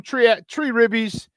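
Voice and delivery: put-on high, slow "tree" for three in Chicago accent. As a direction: drop the h, Chicago style. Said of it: "In my Chicago accent".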